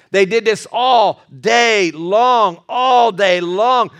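"all day long" slow and weighty, each word held; drawn-out emphasis. A narrator's reading. Dragging out how long it lasted.

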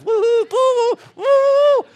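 high, loud rising woo-hoo; party whooping. Retold or imitated. Pastor mimicking whooping teens at the New Year lock-in. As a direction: whoop like a partier at midnight.

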